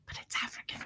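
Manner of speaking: breathy whispered aside; confiding whisper to camera. Acted. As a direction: drop to a secret whisper. Aside after braid story.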